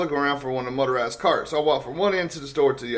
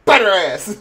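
playful egging on; 5 semitones higher, 6 dB louder than usual. Pretend. Jokingly siccing Ron on someone.

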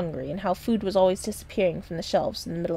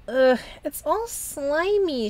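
slow, higher disgusted whine; complaining; 7 semitones higher, much slower than usual. A narrator's reading. Lily climbing into margarine, slow higher disgusted complaint. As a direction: drag out the disgust at the slime. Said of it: "she complained".

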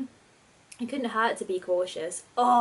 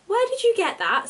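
shocked outburst; 11 semitones higher, 5 dB louder than usual. Acted. Sabrina leaps up in shock.

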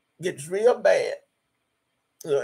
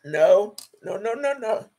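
crying. Real, not acted. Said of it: "I don't care if they see me cry".

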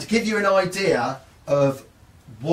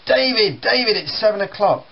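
raised voice calling up the stairs; dad calling upstairs; 6 semitones higher, 3 dB louder than usual. Retold or imitated. Dad calling his son awake from downstairs, loud call. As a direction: call the boy down, higher and louder. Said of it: "My dad calls upstairs".

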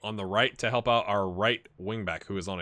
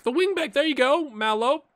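much higher, louder call; peak excitement; 6 dB louder than usual. Real, not acted. Gamer cheering his wing back in the highlight, excited yell. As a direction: yell the name as the ball arrives.

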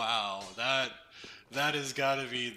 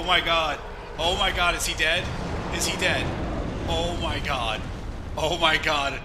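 horrified surprise; 2 semitones higher, 7 dB louder than usual. Real, not acted. Boss nearly dead, disbelief.

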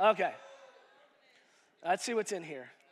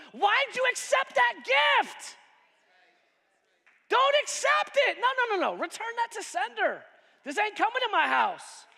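preaching at full force; 9 semitones higher than usual. Acted. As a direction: throw it back to sender. Preaching, refusing the gift.

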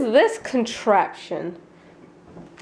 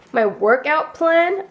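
ordinary delivery

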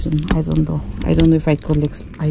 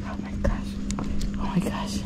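1 awed whisper; 12 dB quieter, faster than usual. Real, not acted. Amazed at the monkeys.

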